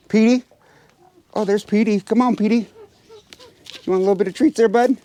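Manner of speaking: high, sing-song call to a pet; high coaxing call to a pet bird; sweet calling voice. Real, not acted. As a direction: coax the bird over with treats.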